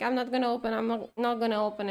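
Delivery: ordinary delivery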